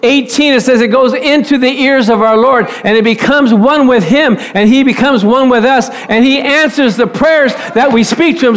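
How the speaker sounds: ordinary talk, nothing marked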